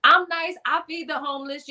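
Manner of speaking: high, pleading self-defense; indignant protest. Retold or imitated. Repeats her protest to God.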